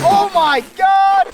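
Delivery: shocked. Real, not acted. Fish escapes or surprises them.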